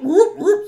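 high loud whooping cheer; robot whoops. Acted.

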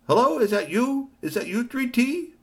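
high hopeful robot. A narrator's reading. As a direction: ask hopefully, pitched high. Robot speaker voice.

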